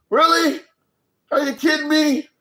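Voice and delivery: can't believe what he's hearing; incredulous disbelief. Real, not acted. Host to his viewers about the pool spending, incredulous outburst.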